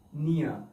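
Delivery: British "near" as nee-uh; British silent r. Shown as a demonstration. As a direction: say "near" the British way, ending in a schwa, no r. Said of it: "is silent in British accent".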